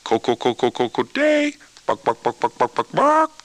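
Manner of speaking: chicken cackle. Shown as a demonstration. Teacher gives the class a model of the cackling, clucking. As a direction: cluck like hens, then squawk. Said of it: "when you hear the chickens cackling".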